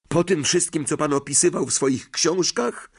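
high, protesting disbelief; incredulous. A narrator's reading. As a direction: press him in disbelief. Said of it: "z niedowierzaniem".

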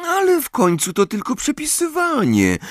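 put-on very high, light girl's voice; high, soothing schoolgirl; consoling girl. A narrator's reading. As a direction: comfort a friend in a high-pitched voice. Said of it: "pocieszyła go Hermiona".

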